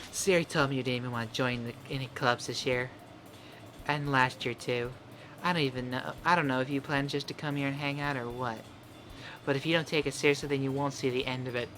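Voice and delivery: put-on soft, snippy girl voice; Natsuki voice. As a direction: scoff as the tsundere. Voicing Natsuki.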